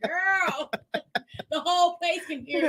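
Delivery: loud, high, breaking into laughter; laughing reproach. Retold or imitated. Friend telling wailing patient everyone hears her, laughing reproach. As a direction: scold loudly through your laughter.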